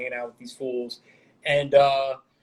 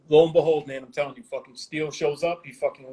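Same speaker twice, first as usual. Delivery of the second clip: ordinary talk, nothing marked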